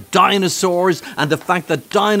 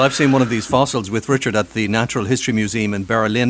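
ordinary delivery

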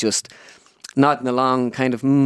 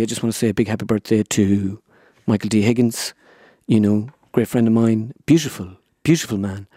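Tommy Tiernan impression. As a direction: linger on every word like a rambling comic.

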